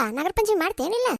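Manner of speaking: ordinary talk, nothing marked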